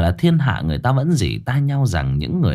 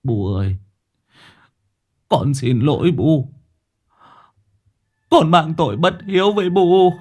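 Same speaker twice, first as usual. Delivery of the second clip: choked plea rising higher to the end; grieving son, pitch climbing; tearful; 7 semitones higher than usual. A narrator's reading. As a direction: apologise to mother on the edge of tears. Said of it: "Ông Tạo mếu máo".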